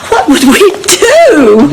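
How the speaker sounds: high, drawn-out wail of worry; fretful, slow, high-pitched cry; panicked wail. Acted. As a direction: stretch it out high, close to tears. Frets over an imagined stroke.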